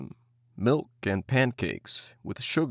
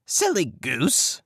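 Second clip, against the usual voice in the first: slow, higher scoffing witch; scornful witch; 6 semitones higher, slower than usual. Acted. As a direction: scoff at the girl. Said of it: "said the old woman".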